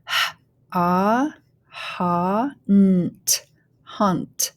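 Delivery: "hunt" built up sound by sound with an ah vowel; teacher blending "hunt" with gaps between sounds; phonics blending. Shown as a demonstration. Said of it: "go ahead and start blending".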